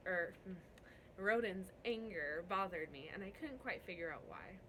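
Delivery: ordinary delivery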